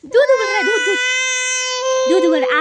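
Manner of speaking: high, loud urgent call about the milk; sudden alarm, shout it out. Real, not acted. Alarm, milk boiling over. (उबलते दूध पर घबराई ऊँची पुकार)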